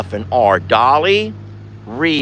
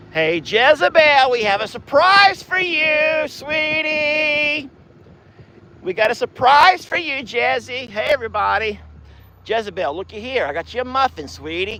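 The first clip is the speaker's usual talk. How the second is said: cooing to cows; 11 semitones higher than usual. Acted. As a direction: coo brightly to greet each cow by name.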